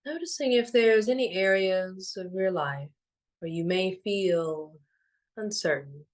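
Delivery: unhurried, soothing and evenly paced; guided meditation. Acted. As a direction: guide them gently into stillness, phrase by phrase.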